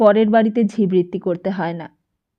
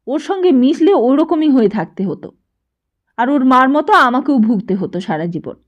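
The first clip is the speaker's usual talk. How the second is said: louder, contemptuous scolding; scornful mother; 3 semitones higher, 8 dB louder than usual. A narrator's reading. Mother scorning the neighbour boy to her son, loud. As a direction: spit out the disdain for that family.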